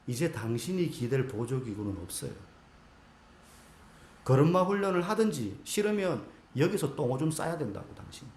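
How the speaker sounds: quiet, level and unbothered; calm, even voice. Retold or imitated. Therapist to the athlete, calm and detached. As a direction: state it flatly, no heat at all. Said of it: "아주 담담한 목소리로".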